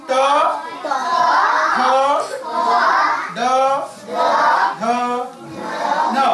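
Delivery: teacher drilling the dental family; clear letter drill. Shown as a demonstration. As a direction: model ta tha da dha na. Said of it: "say after me".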